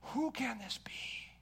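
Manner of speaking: whispered in fearful wonder; awed whisper. Acted. Frightened disciples whispering to one another in awe. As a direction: breathe it out, awestruck. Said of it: "they were afraid and marveled".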